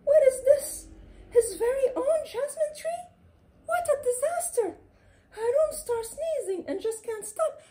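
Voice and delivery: cat panicking as jasmine sprouts; panicked shriek. A narrator's reading. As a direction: shriek in alarm. Cat panics at nose tree.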